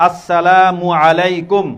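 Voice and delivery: আসসালামু আলাইকুম loud and very slow, syllables stretched; clear, correct pronunciation. Shown as a demonstration. Teacher gives children the whole greeting, slow clear pronunciation. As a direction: model আসসালামু আলাইকুম for a class to echo. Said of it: "স্পষ্ট শুদ্ধ উচ্চারণে কি দিব".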